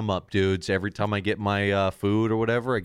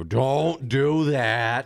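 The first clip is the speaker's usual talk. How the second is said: slow, higher, stretched-out plea; drawn-out warning; 4 semitones higher, 3 dB louder, much slower than usual. Retold or imitated. Company pleading with the public, drawn-out warning. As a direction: imitate an exasperated public warning, word by word. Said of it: "put out a statement like".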